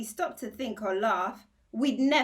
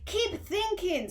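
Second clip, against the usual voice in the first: much higher, slow stern scold; 12 semitones higher, 4 dB louder, slower than usual. Acted. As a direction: scold the boy to keep at it. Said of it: "scolded the watchdog".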